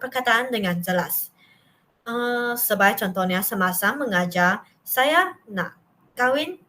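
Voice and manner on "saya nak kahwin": slow, each word set apart with pauses; high, slow, word-by-word demo; words separated. Shown as a demonstration. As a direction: space out saya, nak, kahwin deliberately. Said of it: "Dia boleh memisahkan".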